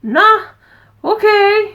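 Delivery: high, loud nonchalant cat voice; high, breezy voice for the unbothered mother cat; voicing the cat. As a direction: play the calm cat shrugging it off. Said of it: "She was just like".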